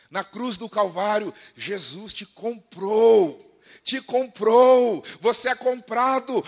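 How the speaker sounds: loud, slow, soaring preacher's cry; full-force sermon climax, slow and ringing; preaching at full force. Acted. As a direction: proclaim it to the congregation, pulling each word out long.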